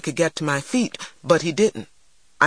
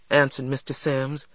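ordinary delivery

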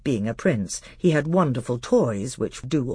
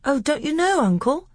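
put-on very high, eager little boy; bright-eyed boy piping up; Caspian; 13 semitones higher, 3 dB louder than usual. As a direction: innocent child surprised his uncle doesn't know.

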